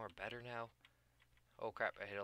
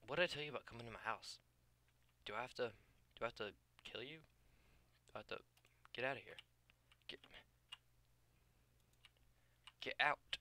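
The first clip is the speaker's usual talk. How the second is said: mock scolding; faster than usual. Scolding mob invading his house.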